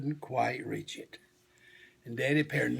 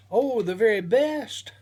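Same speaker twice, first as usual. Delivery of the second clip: higher, louder smiling praise; 8 semitones higher, 5 dB louder than usual. A narrator's reading. Daddy bear praising little bear's den, higher louder smiling. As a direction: beam with pride. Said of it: "smiled Daddy Bear".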